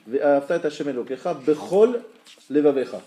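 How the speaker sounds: loud, each word set apart, BECHOL stressed and held; careful distinct reading. Shown as a demonstration. Teacher reading bold letters to the class, careful and distinct. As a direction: read it out firmly, keeping "bechol" and "levavecha" separate. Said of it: "je vais leur lire".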